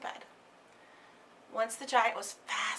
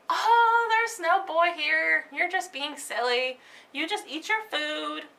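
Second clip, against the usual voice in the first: high, slow, soothing wife's voice covering for Jack; giant's wife; 5 semitones higher, 7 dB louder, slower than usual. Acted. Wife fobs off the giant, high and slow. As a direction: brush off her husband's worries lightly.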